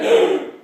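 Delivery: loud acted gasp; big theatrical gasp; frightened gasp. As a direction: suck in air in mock terror. Crossing guard is terrified.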